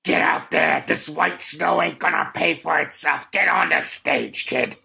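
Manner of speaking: cartoon villain parent barking orders; Disney villain. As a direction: bark it like a scheming stage dad. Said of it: "Why do you sound like a Disney villain".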